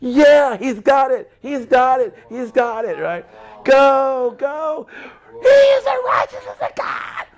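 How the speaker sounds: very high excited cheering, rising to a shout; high cheering crowd building to a shout. Retold or imitated. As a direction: cheer him on like a heavenly crowd. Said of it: "the cloud of witnesses are going".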